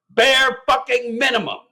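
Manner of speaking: high, exasperated outburst; punchy, incredulous scorn; angry retort. Acted. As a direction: fire back in high-pitched outrage. Furious retort in rant reading.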